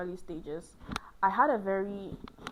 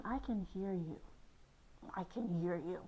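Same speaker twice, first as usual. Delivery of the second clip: soft calming; 2 semitones lower, 9 dB quieter than usual. Acted. Mock argument, calming partner.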